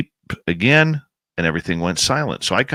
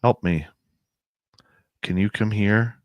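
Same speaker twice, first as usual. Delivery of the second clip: imitating an eerie voice from the trees, monotone; monotone woman's voice. Uncanny woman calling from the woods, lightly voiced, monotone. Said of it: "a woman's voice, very monotone".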